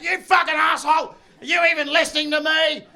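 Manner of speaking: high, loud accusing yell; mock raging wife. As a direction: shout in his face like a furious wife. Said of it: "my wife is in my face".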